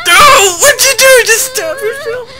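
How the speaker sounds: high, loud, pleading cry; panicked cry. Real, not acted. Player begging Clem to stop stitching, panicked high cry. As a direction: beg the character to stop, panicked.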